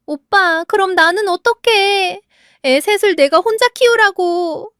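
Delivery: high, loud and pleading; desperate shout. A narrator's reading. Sister crying out to her brother over raising three kids, high loud plea. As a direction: wail it at her brother, cornered. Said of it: "소희가 대신 소리쳤죠".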